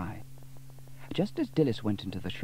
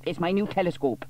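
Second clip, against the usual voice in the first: put-on boy Norman; 8 semitones higher than usual. A narrator's reading. Norman answers mother.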